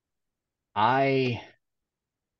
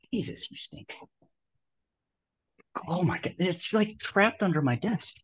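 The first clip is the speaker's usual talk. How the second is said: smelling something awful; 8 semitones higher, 3 dB quieter than usual. Real, not acted. Smell trapped under desk.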